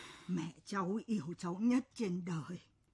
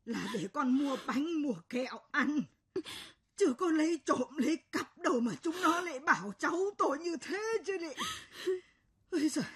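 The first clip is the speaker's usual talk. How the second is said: indignant tearful outburst; 7 semitones higher than usual. Acted. Grandmother defending her grandchild, indignant and tearful. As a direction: protest the injustice as if choking back tears.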